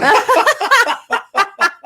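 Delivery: big high burst of laughter; loud laugh. Real, not acted. Laughs at homicide list.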